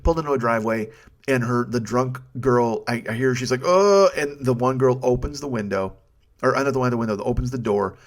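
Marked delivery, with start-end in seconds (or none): 3.7-4.1 retching groan. Retold or imitated. Drunk passenger about to vomit.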